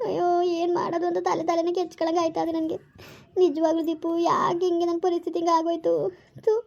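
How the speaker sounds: tearful, despairing wail; crying. Acted. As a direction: lament your fate through acted tears. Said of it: "ನೀನ್ ಹಿಂಗ್ ಅಳ್ಬೇಡ". (ಅಳುತ್ತಾ ಹತಾಶೆಯಿಂದ ಗೋಳಾಟ)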